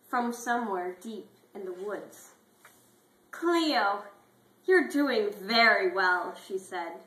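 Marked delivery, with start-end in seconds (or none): 3.3-6.3 loud, slow, gushing praise; encouraging. Acted. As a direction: pump her up with praise.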